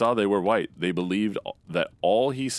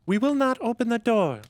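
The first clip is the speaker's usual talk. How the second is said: put-on very high, tiny kid's voice, louder; a little kid reassuring its mother, very high; little goat kid voice; 4 dB louder than usual. A narrator's reading. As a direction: squeak it out like a small goat child. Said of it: "cried they".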